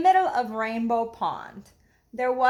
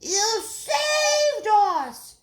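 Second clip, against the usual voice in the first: slow, high, loud cry of relief; high drawn-out joyful shout; 12 semitones higher, 6 dB louder, much slower than usual. Acted. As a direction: call out gratefully. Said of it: "shouted the frogs".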